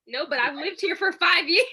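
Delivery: indignant protest. Acted. Acted evicted resident protesting.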